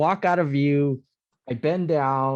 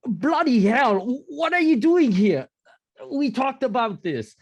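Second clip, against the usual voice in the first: high-pitched British exasperation, put on; bad British accent; 10 semitones higher than usual. Soldier looks quizzically at his dog, bad British accent. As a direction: scold in a hammed-up British accent. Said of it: "my shitty British accent".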